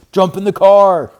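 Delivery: loud, slow shout; cried. A narrator's reading. Papa shouting to the cubs to jump in the car. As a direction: bellow the order. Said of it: "cried Papa".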